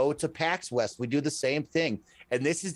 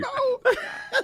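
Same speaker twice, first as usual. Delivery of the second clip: a sudden high laugh; high burst of laughter. Real, not acted. Guest laughing at the host's own joke.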